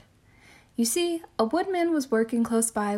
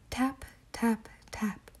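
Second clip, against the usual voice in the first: soft tapping; 7 dB quieter, slower than usual. Acted. Wolf knocks softly.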